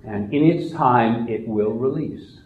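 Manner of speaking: normal talking voice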